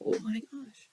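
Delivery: stunned whisper. Real, not acted. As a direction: breathe it out, unvoiced. Stunned by the message.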